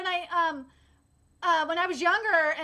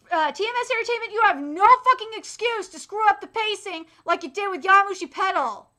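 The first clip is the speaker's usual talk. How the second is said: mock scolding; 5 semitones higher, 6 dB louder than usual. Warning the studio about pacing.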